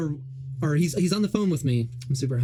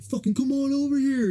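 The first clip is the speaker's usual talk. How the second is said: higher, aggressive imitation of a hostile stranger; retold taunt, pitched up and mocking; mock tough-guy taunt; 10 semitones higher than usual. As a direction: ham up the bully calling him over.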